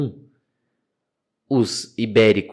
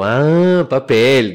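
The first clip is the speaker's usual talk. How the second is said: loud, drawn-out "ah" of sudden understanding; hammed-up delighted discovery; excited realisation; 3 semitones higher, 8 dB louder, much slower than usual. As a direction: act out a big, loud moment of getting it. Acts his delighted sudden realisation.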